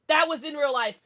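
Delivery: usual speaking voice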